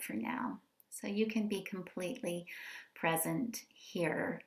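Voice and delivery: unhurried meditation voice with long pauses; guided meditation. Acted. Inviting an online community into presence and rest, guided meditation. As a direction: ease listeners into stillness, phrase by phrase.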